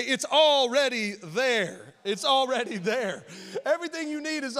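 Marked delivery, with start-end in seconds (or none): 0.0-3.2 very slow, louder and higher; slow emphatic repeat. Shown as a demonstration. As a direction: stretch each word for the neighbor. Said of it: "Look at your neighbor, say".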